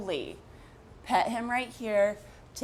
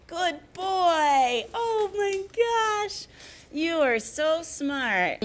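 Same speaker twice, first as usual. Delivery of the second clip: high-pitched praise voice; 10 semitones higher, much slower than usual. Real, not acted. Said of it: "that high-pitched voice that I've been doing".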